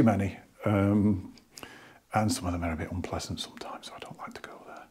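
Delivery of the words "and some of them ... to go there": hushed whisper about the nasty comments. Real, not acted. Whispering to viewers about unpleasant commenters. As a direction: drop to an unvoiced whisper, as if sharing a secret.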